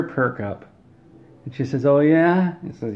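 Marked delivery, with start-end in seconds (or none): 1.9-2.5 high, loud eager oh yeah; suddenly keen and interested; perking up. Acted. Girlfriend perks up at the new game, excited.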